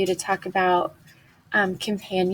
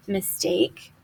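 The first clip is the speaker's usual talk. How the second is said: ordinary delivery, nothing marked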